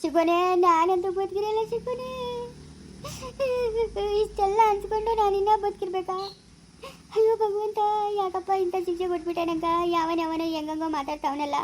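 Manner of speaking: hammed-up sobbing wail; tearful lament. Wife laments to God over the insults, wailing.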